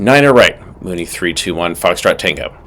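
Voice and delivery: usual speaking voice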